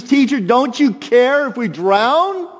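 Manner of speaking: high, loud, slow desperate cry; panicked disciples. Acted. Disciples shouting to Jesus in the storm, high and loud. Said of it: "the disciples shouted".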